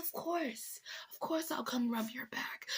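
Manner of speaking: hushed, breathy whisper of fake devotion; a soft, sarcastic whisper. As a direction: whisper it with mock sweetness. Sarcastic mock reply.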